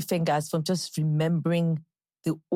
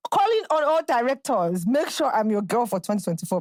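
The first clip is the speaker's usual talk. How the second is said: higher-pitched public appeal; calling out; 5 semitones higher than usual. Real, not acted. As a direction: put out a call to all directors. Appeal to film directors.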